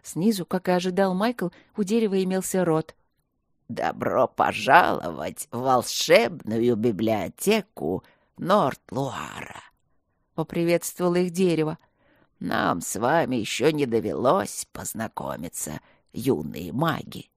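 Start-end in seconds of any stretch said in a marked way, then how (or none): 3.7-9.6 deep, slow booming tree voice; a wise ancient tree greeting warmly; talking tree. Acted. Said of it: "Голос дерева звучал басовито". 12.4-17.3 deep, slow booming tree voice; a wise ancient tree greeting warmly; talking tree. Acted. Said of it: "Голос дерева звучал басовито".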